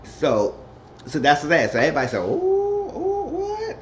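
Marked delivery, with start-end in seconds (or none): 2.2-3.8 very high, drawn-out mock gasping; shocked group reaction. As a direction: imitate a scandalized crowd, pitched way up.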